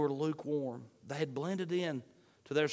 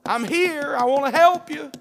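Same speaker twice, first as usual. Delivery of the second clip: louder, higher pleading voice; urgent loud plea; 9 semitones higher, 11 dB louder than usual. Acted. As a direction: beg them to open, loud and urgent. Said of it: "He says".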